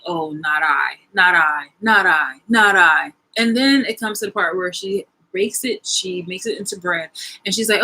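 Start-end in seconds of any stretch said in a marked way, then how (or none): 0.1-3.1 animals refusing, building. Acted. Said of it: "they're just like".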